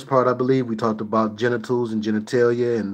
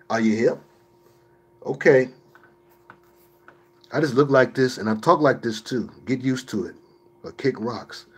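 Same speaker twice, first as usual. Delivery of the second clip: ordinary talk, nothing marked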